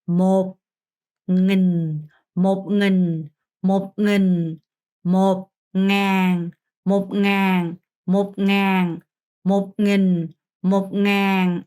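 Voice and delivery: slow, drawn-out 몹 는 and 넹, each syllable held; sustained, level syllables on 몹, 는, 넹; careful pronunciation demo. Shown as a demonstration. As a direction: model 는 and 넹 slowly for learners, with a long ng onset. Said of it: "발음이 어려우니까 잘 듣고".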